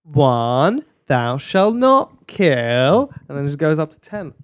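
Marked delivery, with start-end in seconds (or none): 0.0-3.1 very slow, drawn-out mock recital; stretched, deliberate reading of a commandment. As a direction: count it out ponderously. Mock counting through the commandments.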